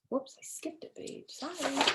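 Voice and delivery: under-breath aside about a skipped page; quiet reader slip; whispered aside. Real, not acted. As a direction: mutter the mistake to yourself.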